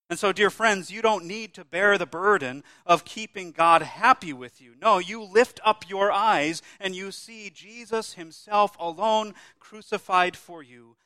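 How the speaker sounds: loud, ringing proclamation slowing at the end; full-force preaching. Acted. Preacher to congregation retelling the crucifixion, booming preaching. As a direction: thunder it out from the pulpit.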